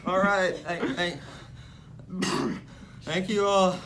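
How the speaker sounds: slow, loud speech broken by coughs; hammed-up sick speaker. Sick president thanking the crowd, slow and coughing. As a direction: push through the speech while coughing.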